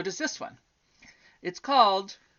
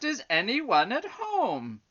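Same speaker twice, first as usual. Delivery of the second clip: slow, raised call; quite loud; 10 semitones higher, 10 dB louder, slower than usual. A narrator's reading. Butterfly asking on the third knock, quite loud. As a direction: call out loudly, stretching each word. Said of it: "this time quite loudly".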